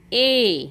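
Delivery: ए as a lone held "eh" vowel; phonic E sound. Shown as a demonstration. Teacher showing the sound of E, ए as "eh". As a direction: sound out ए on its own. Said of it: "ईचा उच्चार होतो".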